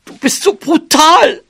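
very high outraged mouse; scolding. Acted. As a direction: shriek the accusation in mouse pitch. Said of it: "schimpfte sie". (hoch und empört geschimpft)

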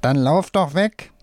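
puppet's shout. A narrator's reading. Right hand tells Wolfram to flee, mild puppet shout. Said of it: "die rechte Hand schreit".

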